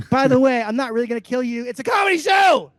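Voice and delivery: loud, amused backpedal; laughing shout. Acted. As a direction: yell it over the laughter, half joking. Shouting disclaimer after mock threat.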